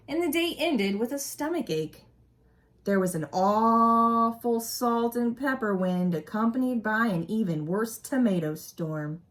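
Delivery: storybook narration for a class; expressive read-aloud story voice; reading aloud to children. A narrator's reading. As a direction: read it like a teacher sharing a picture book. Reading picture book aloud.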